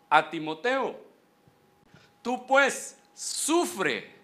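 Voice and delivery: emphatic sermon delivery, slowing on key words; preaching. Acted. Pastor quoting Paul to the flock, fervent preaching. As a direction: press the verse into them.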